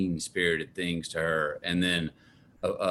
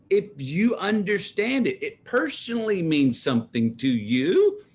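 imitating mom; 11 semitones higher than usual. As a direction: draw it out, persuading. Mom voiced in story.

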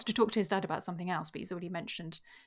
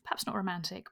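ordinary talk

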